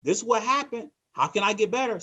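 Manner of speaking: upbeat agent asking the manager how to improve; upbeat and level. Shown as a demonstration. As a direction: come in eager to get better. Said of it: "if you come in level".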